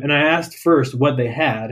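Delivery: usual speaking voice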